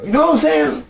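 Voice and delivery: very high, loud squeal; Dave Chappelle impression. Fan showing how the comedian talks, squealed high in imitation. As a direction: mimic a comedian's sudden high-pitched catchphrase. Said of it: "Sometimes when he's talking, he does go".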